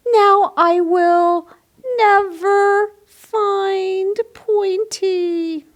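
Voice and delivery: a tiny dino calling for help; squeaky-high storybook critter; little dinosaur voice. Acted.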